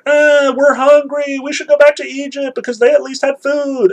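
mocking complainers in a high nasal whine; whining Israelites. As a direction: whine like a hungry grumbling crowd.

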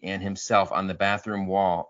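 normal talking voice

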